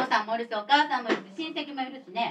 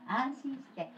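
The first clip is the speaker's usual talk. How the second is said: softer, voice catching with real tears; choked up; 5 semitones lower, 10 dB quieter than usual. Real, not acted. Teacher blesses the children, softly choked up. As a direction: hold back tears as you bless them.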